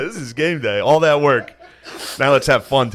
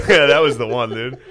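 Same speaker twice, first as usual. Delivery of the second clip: normal talking voice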